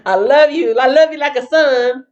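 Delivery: mock-sweet doting father; doting King Saul. King Saul dotes on young David, hammed-up laughing fondness. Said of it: "going from this".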